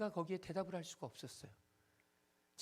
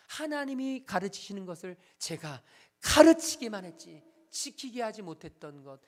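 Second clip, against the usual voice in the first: ordinary delivery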